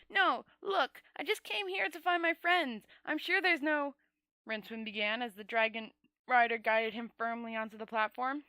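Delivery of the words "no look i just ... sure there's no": high protest. A narrator's reading. Rincewind's protest.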